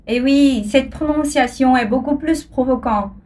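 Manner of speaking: ordinary delivery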